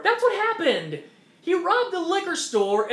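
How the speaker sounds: loud, high-pitched outrage; heated exclaim. Real, not acted. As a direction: drive it home angrily. Heated argument.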